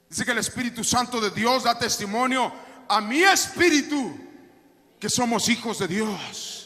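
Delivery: ordinary talk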